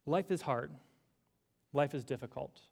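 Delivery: ordinary talk, nothing marked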